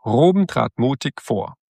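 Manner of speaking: usual speaking voice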